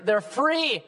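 normal talking voice

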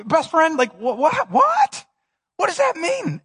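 high, incredulous cry of what; baffled outburst. Retold or imitated. Asking his best friend about losing your life, baffled. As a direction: throw up your hands in confusion. Pastor acting baffled question.

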